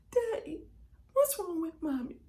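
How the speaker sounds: put-on high, loud little-kid voice; child's puzzled question. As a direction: ask Daddy like a child who doesn't understand. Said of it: "you wasn't scared".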